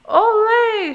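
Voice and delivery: high, loud cheering shout; crowd shout. Acted. Crowd shouts at the circus, loud cheer. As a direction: cheer like a whole arena. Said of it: "shouted the crowd".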